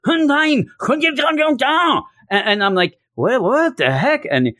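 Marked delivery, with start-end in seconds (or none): 0.1-2.0 very high, hammed-up angry German gibberish; harsh mock German. German aunt answering her nephew's hello, harsh mock German. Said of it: "it is, it's harsh". 3.2-4.2 high-pitched, hammed-up bewilderment; baffled reaction. Nephew hearing his German aunt's outburst, comically baffled.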